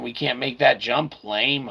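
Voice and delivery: normal talking voice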